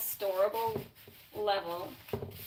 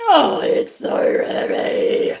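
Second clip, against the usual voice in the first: straining under weight; 4 semitones higher, 10 dB louder, much slower than usual. Real, not acted. Lifting heavy vise.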